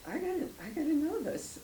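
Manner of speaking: high, eager inner voice; a sudden burning need to know; eager realization. Retold or imitated. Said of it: "I was like".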